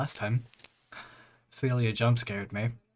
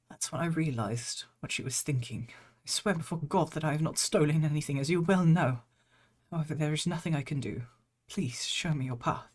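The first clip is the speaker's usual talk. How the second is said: higher, gentle maid reading; maid character voice; 6 semitones higher than usual. Acted. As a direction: voice the weary maid, raised pitch. Reads maid's prayer text.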